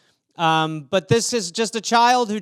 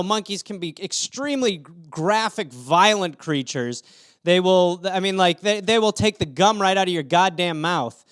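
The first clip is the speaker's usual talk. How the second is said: usual speaking voice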